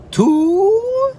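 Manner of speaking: very high squeaky rising "Two"; exaggerated toddler echo. Dad imitating his son's exaggerated high echo of two. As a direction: overdo it like a toddler copying. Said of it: "He says it exaggerated like that".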